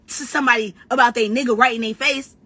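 usual speaking voice

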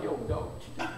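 a hushed, breathless whisper; whispered bouncer. Retold or imitated. The bouncer silently asking what he's doing, whispered. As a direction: mouth it like a stunned bouncer. Bouncer's baffled look voiced.

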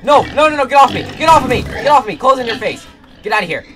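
screaming get off me; frantic yells, high and loud; panicked screaming. Real, not acted. Gamer attacked, screaming in panic.

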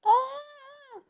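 sharp high yelp of surprise; startled cry. Real, not acted. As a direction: yelp as you start to fall. Falling in the game.

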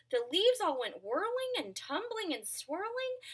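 high, loud, frantic pleading; squeaky monkey voices. Acted.

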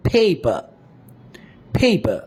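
পেপার as "phay-pa", breath only on the first p; aspirated first P. Shown as a demonstration. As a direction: puff the first p of পেপার, keep the second plain. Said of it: "সেটাকে প এর মতো উচ্চারণ করব". (পেপার, প্রথমটা ফ, পরেরটা প-এর মতো)

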